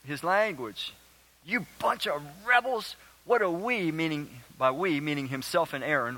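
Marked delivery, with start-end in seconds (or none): 1.4-3.9 a touch of exasperated scolding, higher; fed-up scolding. Acted. Moses losing patience with the complaining people, mild scold. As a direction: mildly play Moses snapping at the rebels. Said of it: "he blows his stack a little bit".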